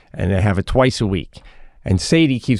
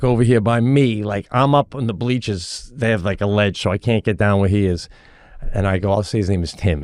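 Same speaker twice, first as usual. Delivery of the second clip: usual speaking voice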